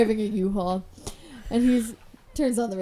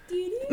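snake charmer tune; 12 semitones higher than usual. Retold or imitated. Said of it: "And then the snake goes".

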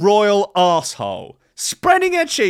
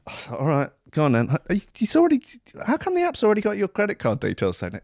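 normal talking voice

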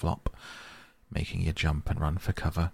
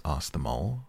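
ordinary delivery

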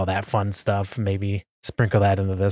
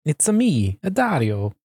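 higher, playful Italian-accented Mario imitation; cartoon Italian accent, playfully put on; Mario-style Italian accent; 5 semitones higher than usual. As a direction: do a jokey Italian video game plumber. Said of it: "because it's like Mario or Mario".

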